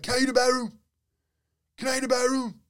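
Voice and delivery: high, loud mock impaired speech; hammed-up impression of a hearing-impaired kid; imitating deaf speech. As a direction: mimic a kid who can't hear himself talk. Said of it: "someone that's hearing impaired to try to speak".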